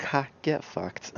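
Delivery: slow, sneering taunt; mocking taunt. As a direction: sneer it out slowly like a gloating game. Game mocking the unlucky player.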